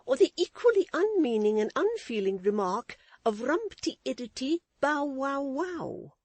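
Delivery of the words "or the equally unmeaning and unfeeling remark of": withering scorn. A narrator's reading. Wife mocking her husband's song, with withering scorn. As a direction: sneer the silly words. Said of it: "in a tone of withering scorn".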